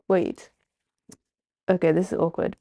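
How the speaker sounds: ordinary delivery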